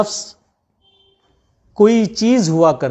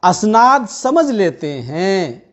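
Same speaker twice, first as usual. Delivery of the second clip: measured Urdu poetry recitation; tender romantic poetry; 4 semitones lower than usual. Acted. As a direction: recite each couplet with feeling. Poet reciting at mushaira.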